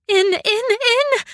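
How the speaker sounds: very high, strained plea; desperate gasp. Acted. Mei Ling fights to breathe, desperate. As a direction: force the word out gasping. Gasping for air.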